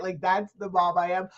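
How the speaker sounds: normal talking voice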